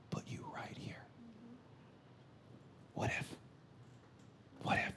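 whispered, pausing after each phrase; soft, spaced-out whisper; hushed appeal. Real, not acted. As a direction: drop to a whisper and let it hang.

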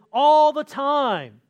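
loud, high, slow call-back; shouted response. Retold or imitated. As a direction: shout the answer back as a crowd. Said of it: "we would respond with".

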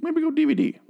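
high-pitched teasing aside; mock urging. Podcaster urging a switch to DVD, high-pitched mock urging. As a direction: pitch it way up in jest.

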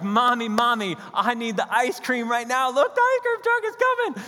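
put-on high, loud, pleading child begging for ice cream; little boy yelling for ice cream as the truck comes; excited kid. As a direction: play the boy flipping out over the truck. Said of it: "he starts flipping out".

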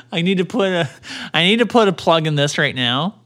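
usual speaking voice

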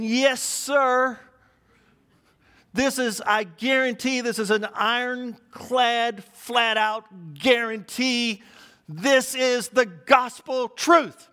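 hammed-up, slow, emphatic guarantee; emphatic vouching. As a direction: stretch each phrase like a solemn personal guarantee. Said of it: "he was so emphatic".

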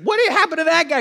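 high, loud eager question; astonished fisherman. Acted. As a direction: demand the news, pitch shooting up.

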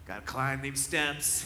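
put-on slow, tired old dog voice; groaning through it; a hint of a weary old dog. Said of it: "he'd just be like".

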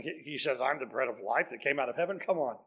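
low, soft grumbling crowd, scoffing; a quiet, scornful grumble. Retold or imitated. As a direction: mutter it like doubters mocking a claim. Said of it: "grumbling and saying".

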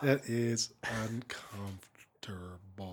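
hushed, slow whisper of mock unease; whispered aside. Real, not acted. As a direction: drop to a whisper for the awkward joke. Awkward aside after racist-accent joke.

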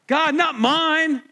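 high, loud and slow, God stretched out; emphatic answer. Real, not acted. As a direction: land each word to the congregation. Preacher answering own question.

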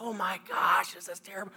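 hushed, breath-only dismay; aghast whisper. Retold or imitated. Hired hand watches track torn up, aghast whisper. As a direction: whisper it in mock horror, fading away.

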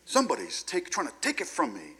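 high, agitated inner voice; mock alarm. Self-preservation speaking up in anger, alarmed. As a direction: pitch it up like a threatened protest.

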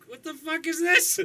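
high, loud, drawn-out freak-out; panicked nerves. Retold or imitated. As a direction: reenact losing your nerve under pressure. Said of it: "I started feeling nerves".

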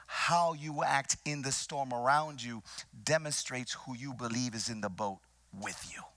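ordinary talk, nothing marked

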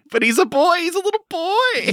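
high-pitched aww voice; high cute voice. Real, not acted. Cooing over the baby-like character.

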